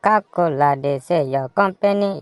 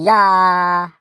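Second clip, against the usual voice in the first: normal talking voice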